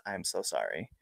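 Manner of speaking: low, hushed retold apology; remorseful apology. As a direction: say it softly, full of regret.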